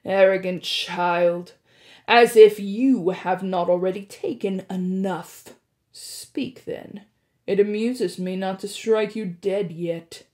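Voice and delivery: cold, looming disdain; menacing god. Acted. Hades voiced, menacing.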